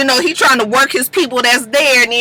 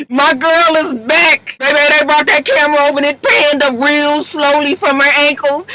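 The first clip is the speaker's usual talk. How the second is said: ordinary talk, nothing marked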